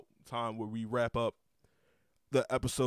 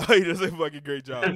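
loud, higher-pitched burst of praise; emphatic praise; 6 semitones higher, 15 dB louder than usual. Real, not acted. Podcaster shouting out his therapist, emphatic praise. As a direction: say it louder, laughing as you start.

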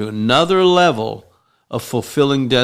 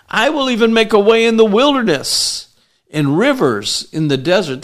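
full-force preaching; 6 semitones higher than usual. Acted. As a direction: proclaim it like a prophet to the crowd.

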